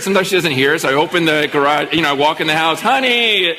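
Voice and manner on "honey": light sing-out to someone in another room; calling out. Retold or imitated. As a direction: call for your child through the house. Dad calling into the house.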